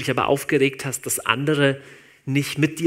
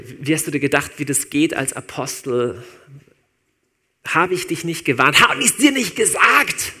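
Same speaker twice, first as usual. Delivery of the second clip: mock scolding; 6 dB louder than usual. Imagined scolding, rising.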